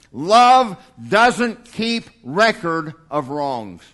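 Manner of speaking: slow, deliberate quoted verse; slow scripture reading. Acted. Preacher reading the verse to the church, slow and deliberate. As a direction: lay each word down slowly.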